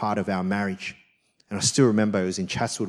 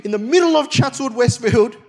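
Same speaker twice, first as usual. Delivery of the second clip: much higher, louder mock challenge to a second fight; 6 dB louder than usual. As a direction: taunt your wife into round two, hammed up. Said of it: "be like".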